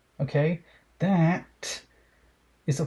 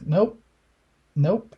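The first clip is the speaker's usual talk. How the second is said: emphatic nope; 5 semitones higher, 5 dB louder than usual. Real, not acted. Rejecting a gross message.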